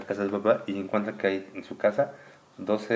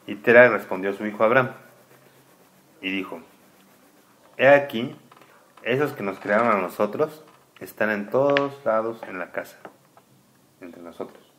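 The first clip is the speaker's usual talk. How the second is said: lectura solemne; 2 semitones higher, 9 dB louder than usual. Acted. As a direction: read it like sacred text to a child. Reads scripture aloud. (lectura solemne en voz alta, más fuerte y pausada)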